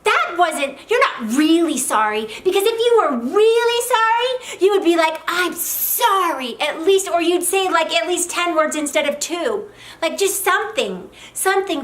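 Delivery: loud, high, indignant complaint; emotion-based wife. Acted. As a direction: protest hotly as a dissatisfied wife. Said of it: "the emotion-based wife is like".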